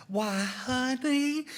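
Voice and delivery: high, drawn-out syrupy Southern drawl; fake Southern accent. Mocking Hollywood's Southerners on TV, fake Southern accent. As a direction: overdo a honey-dripping TV Southern accent. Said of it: "any program about Southerners on T V".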